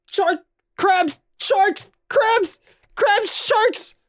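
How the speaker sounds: high screaming panic; panicked shouting. Acted. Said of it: "Donnie splashes off going".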